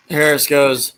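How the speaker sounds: ordinary delivery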